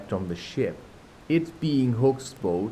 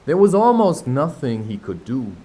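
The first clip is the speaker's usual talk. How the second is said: ordinary delivery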